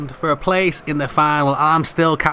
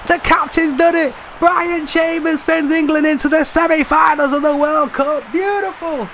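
very high, stretched-out cry of triumph; last-minute winner euphoria, voice soaring; ecstatic goal call; 11 semitones higher than usual. Real, not acted. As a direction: scream the winning goal like a delirious commentator. Calling a last-minute winning goal.

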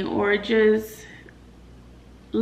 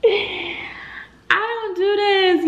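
much higher and slow, voice cracking with tears; voice breaking; 12 semitones higher, 3 dB louder, slower than usual. Real, not acted. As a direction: let the tears break through the words. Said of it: "Y'all about to cry for real".

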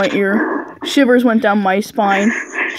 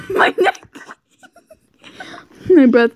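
ordinary talk, nothing marked

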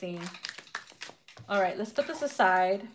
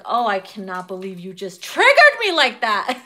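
laughing annoyed; 3 semitones lower, 6 dB louder than usual. Retold or imitated. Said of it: "you're like".

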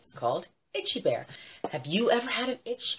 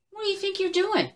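affronted squirrel; 13 semitones higher, 5 dB louder than usual. Acted. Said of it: "And Mister Squirrel said".

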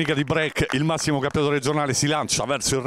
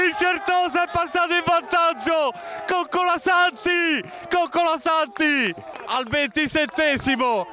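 breathless italian goal commentary; 9 semitones higher than usual. Real, not acted. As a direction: yell the goal call at full pitch. Live goal call.

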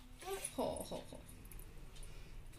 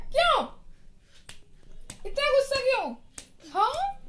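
playful scolding; 13 semitones higher, 5 dB louder than usual. Real, not acted. Playfully questioning angry toddler. (बच्ची से बहुत ऊँची आवाज़ में लाड़ भरी डाँट)

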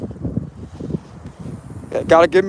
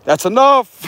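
higher, louder stern call; judgment card; 5 semitones higher, 5 dB louder, slower than usual. Acted. The judgment card orders Scorpio to stop, mock-stern call. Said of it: "that'll be like the judgment card".

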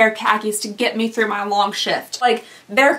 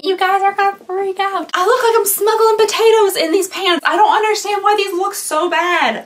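high, exasperated rant; high-pitched, ranting complaint; worked-up yelling; 7 semitones higher than usual. Real, not acted. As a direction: yell it in outraged disbelief. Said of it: "that was so yelly".

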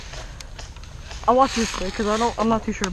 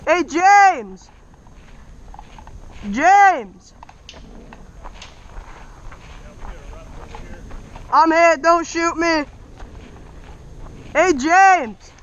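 man down shout; 5 semitones higher, much slower than usual. Real, not acted. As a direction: shout across the field. Calling teammate after being hit.

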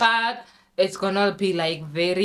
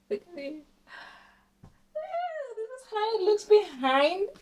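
high slow display; 14 semitones higher, slower than usual. Real, not acted. Showing the back.

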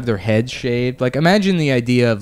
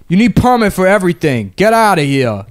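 put-on higher, louder gruff Italian-American accent; 7 semitones higher, 4 dB louder than usual. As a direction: shoo him out in a mob Italian accent. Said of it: "that Italian accent".

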